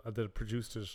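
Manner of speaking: ordinary talk, nothing marked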